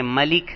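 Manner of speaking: ملک said malik, a after m and i after l; pronounced malik. Shown as a demonstration. Teacher showing students the reading malik. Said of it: "کبھی اس کو کہتے ہیں".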